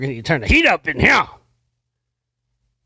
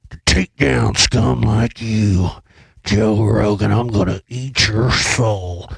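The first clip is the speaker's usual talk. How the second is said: lower, slow, menacing holy-war rant; Jones impersonation; 4 semitones lower, slower than usual. Pretend. Podcaster parodying Jones vowing to kill Rogan, lower slow rant. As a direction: grind each threat out slowly like a ranting radio host. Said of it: "does my Jones impersonation".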